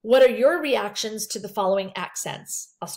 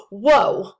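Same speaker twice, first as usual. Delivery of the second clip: shocked gasp; 9 dB louder than usual. Shown as a demonstration. Teacher demos a shocked counselor gasping whoa. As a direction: gasp then a loud startled whoa. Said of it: "you don't wanna be like".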